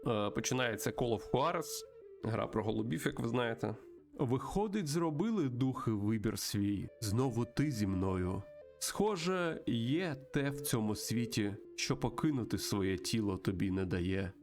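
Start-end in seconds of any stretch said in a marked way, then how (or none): 4.2-14.3 measured, mystical storytelling voice; solemn spirit guide. Acted. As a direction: intone it like an ancient guide to a lost soul. Voices spirit guide Great Mountain. (урочистий голос духа-наставника)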